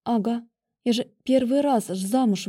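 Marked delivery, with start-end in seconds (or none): none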